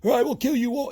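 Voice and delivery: a ranting deity's threat, hammed up and high; mock angry God. Jealous God demanding worship from everybody, in a mock angry voice. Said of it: "get all angry and stomp his feet".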